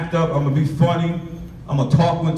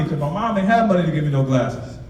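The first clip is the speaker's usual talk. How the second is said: ordinary talk, nothing marked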